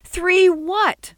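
high, loud exasperated outburst; frustrated yell. A narrator's reading. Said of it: "Sol yelled in frustration".

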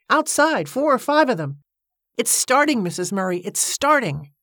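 high-pitched excited report. A narrator's reading. Cornelia telling Missus Murray it's starting, excitedly. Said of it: "she said excitedly".

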